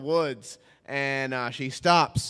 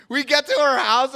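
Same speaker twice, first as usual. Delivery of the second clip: ordinary talk, nothing marked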